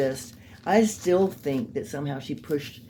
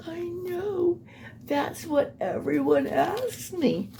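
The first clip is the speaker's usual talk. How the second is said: high, slow, fake-tearful imitation of her; a whimpering, pitiful fake cry; mock weeping; 14 semitones higher, slower than usual. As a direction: put on her pretend sobbing voice. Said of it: "she started pretend weeping".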